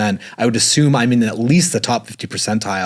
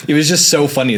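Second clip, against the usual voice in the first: ordinary talk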